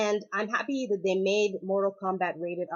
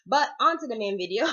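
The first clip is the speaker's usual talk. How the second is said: normal talking voice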